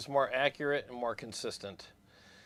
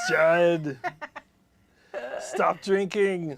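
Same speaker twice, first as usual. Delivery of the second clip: loud, slow, higher mock Arnold; 3 semitones higher, 5 dB louder, much slower than usual. Co-host shows the Arnold yell, slow and loud. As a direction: bark it slowly like a hammed-up Terminator. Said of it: "the Jared was okay".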